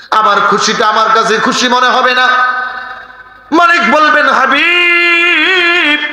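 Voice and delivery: high, half-sung sermon chant; chanted pulpit exhortation; melodic preaching. Acted. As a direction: intone it high, holding the phrase endings. Preacher chants dialogue with God.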